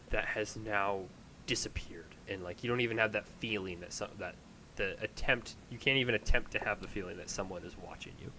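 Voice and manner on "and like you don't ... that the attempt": normal talking voice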